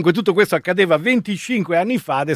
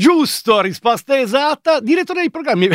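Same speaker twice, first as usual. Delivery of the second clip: showy game show host; 6 semitones higher than usual. Pretend. Quiz host confirms answer.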